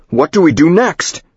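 high, loud yell. Acted. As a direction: shout it up high. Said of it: "The speakerphone yells".

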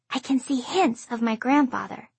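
ordinary delivery, nothing marked

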